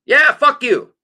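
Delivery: high, loud outraged snap. Real, not acted. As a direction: shout him down, indignant. Outraged reply to co-host.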